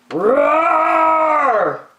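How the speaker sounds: loud, high, hammed-up roar; cranky roar. The bear roars from the cave, loud. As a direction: let the roar burst out of the cave.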